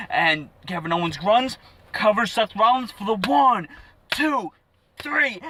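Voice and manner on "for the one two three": high, punched, with suspenseful pauses; excited ringside-style count; dramatic pinfall count. Retold or imitated. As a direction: draw out the winning count. Re-enacting the winning pinfall count.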